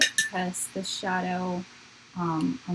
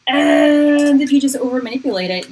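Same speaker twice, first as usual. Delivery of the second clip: much louder, higher warning; emphatic teacher warning; 7 semitones higher, 15 dB louder than usual. Real, not acted. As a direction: stress it like an art teacher. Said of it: "my little art teacher heart will cry".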